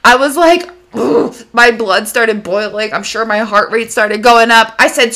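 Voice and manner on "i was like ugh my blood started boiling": ordinary talk, nothing marked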